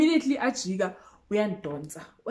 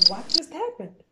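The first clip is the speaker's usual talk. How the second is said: higher, bewildered question; stunned disbelief; 7 semitones higher than usual. Retold or imitated. Narrator left wet and confused, stunned. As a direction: ask it in shock.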